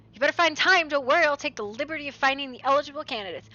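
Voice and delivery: normal talking voice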